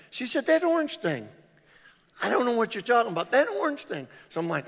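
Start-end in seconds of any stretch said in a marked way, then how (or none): 0.5-1.3 high, insistent mock wife; wife insisting to husband, high pitched. 2.2-4.1 high, quick mock wife; wife losing patience with husband, high and fast; exasperated wife. Wife voiced insisting.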